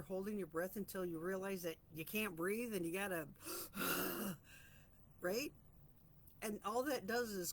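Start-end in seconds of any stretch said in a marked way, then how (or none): 3.3-4.6 sharp audible intake of air; demonstrated gasp. Shown as a demonstration. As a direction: show the gasp of breath finally coming back. Demonstrating a sudden breath.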